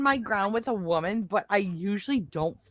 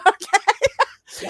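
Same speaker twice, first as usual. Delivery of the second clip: laughing; 10 semitones higher, 6 dB louder than usual. Real, not acted. Laughing at her own story.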